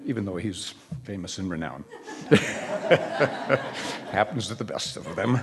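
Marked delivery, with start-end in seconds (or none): 1.9-4.0 laugh. Real, not acted. Laughs at own joke.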